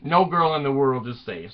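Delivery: ordinary talk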